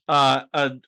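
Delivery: normal talking voice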